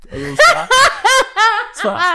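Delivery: high laughing delivery. Real, not acted. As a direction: laugh out the words. Laughs retelling hair wash.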